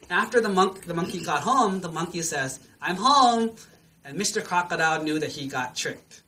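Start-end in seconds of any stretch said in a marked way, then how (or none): 2.8-3.6 high, loud announcement; triumphant call. Acted. Monkey safely back across the river, calling triumphantly. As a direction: call it out, pitched up.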